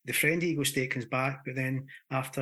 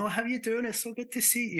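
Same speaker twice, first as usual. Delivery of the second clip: much higher, delighted hello; gushing friendly greeting; 9 semitones higher, faster than usual. Acted. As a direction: act out an over-friendly welcome, pitched high. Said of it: "You're not gonna be going".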